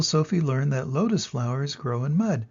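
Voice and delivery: usual speaking voice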